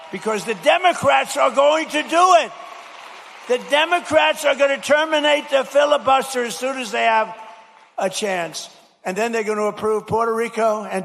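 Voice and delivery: fired-up stump speech shout; rally shout. Real, not acted. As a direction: ring it out to the back of the arena. Rally warning about Democrats.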